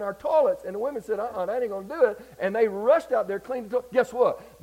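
loud, high preacher storytelling; excited pulpit delivery, pitch riding high; fired-up preaching. Real, not acted. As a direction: build the tale with revival energy. Preaches the story at full force.

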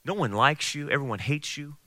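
hushed, needling inner tempter; devil's whisper. Acted. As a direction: speak softly, planting doubt. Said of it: "the devil will whisper into your ear".

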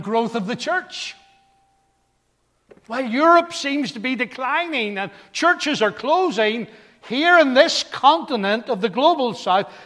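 loud, emphatic pulpit delivery, rising in pitch; rousing preaching. Real, not acted. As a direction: build from firm listing to high proclamation. Preaches about the global church.

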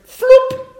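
high, loud cartoon floop; comic chop sound. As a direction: make the noise of the ear flying off. Ear chopped off.

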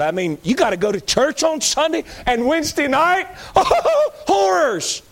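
high, laughing mock dismay; mock horror. Preacher mocking gloomy churchgoers, high-pitched mock horror.

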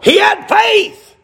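high, drawn-out preacher's shout; real pulpit exclamation; emphatic proclamation. Real, not acted. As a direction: cry it out slowly to the congregation. Preacher proclaims the story's point.

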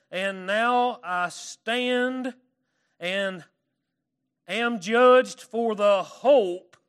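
slow, loud, drawn-out scripture reading; preaching scripture. Acted. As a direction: proclaim each phrase slowly to the congregation. Reads Paul's words in church.

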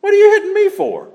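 hammed-up offended donkey; talking donkey. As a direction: squeak out the donkey's complaint. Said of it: "The donkey turns around and says".

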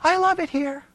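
high, soft, blissful put-on voice; hammed-up serene delight; mock contentment. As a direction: sweetly play a too-saintly prisoner. Mocking contented Joseph in prison.

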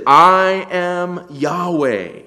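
loud, high, long-held royal proclamation; Yahweh. Acted. God speaking as king, proclaiming with full force. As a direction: declare it with the force of a decree. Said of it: "carry the force of a royal decree".

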